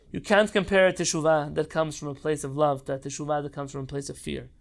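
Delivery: usual speaking voice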